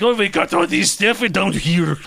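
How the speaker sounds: high-pitched comic Scots; mock Scottish accent. Co-host joining the Scotland joke, mock Scottish accent. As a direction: put on a jokey Scottish voice. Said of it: "objectively funny accent".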